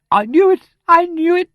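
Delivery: very high, loud hysterical outburst; reckless shriek. A narrator's reading. Niece after hearing of the death, reckless shriek. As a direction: shriek it with no restraint. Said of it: "shrieked Miss Twitterton recklessly".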